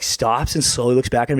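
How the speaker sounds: ordinary talk, nothing marked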